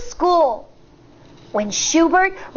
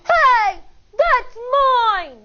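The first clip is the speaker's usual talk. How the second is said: higher protest from the floor; shout; 6 semitones higher, slower than usual. A narrator's reading. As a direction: cry out that it's yours. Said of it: "shouts Schubert from the floor".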